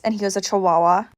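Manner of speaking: ordinary talk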